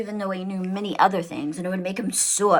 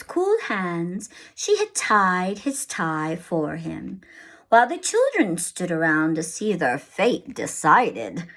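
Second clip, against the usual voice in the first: plain reading voice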